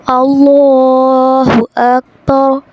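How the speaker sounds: अल्लाहु अकबर very slow, with a long-held full लाम; slow measured chant of अल्लाहु अकबर; careful tajweed recitation. Shown as a demonstration. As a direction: draw out अल्लाहु, then place अकबर carefully. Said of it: "बिल्कुल तजवीद के साथ सीखेंगे".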